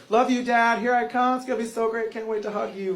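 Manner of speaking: lightly voiced loving child, running on; mock sweet daughter. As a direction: imitate a daughter gushing to her dad. Acting sweet daughter before sudden turn.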